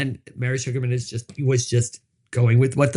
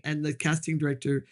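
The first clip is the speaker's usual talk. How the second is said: ordinary talk, nothing marked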